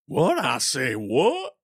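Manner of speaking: a loud Southern accent, like a cartoon rooster. To his cohost, joking about the southern bald eagle in a Southern accent. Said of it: "gave it, like, a southern accent".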